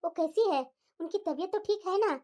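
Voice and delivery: low, calm mother voice; mother calming her angry daughter, low and gentle; soothing mother. Acted.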